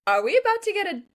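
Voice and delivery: high, loud squeal of a question; thrilled disbelief. Acted. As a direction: squeal it in rising, giddy disbelief. Reader's shocked, thrilled reaction acted out.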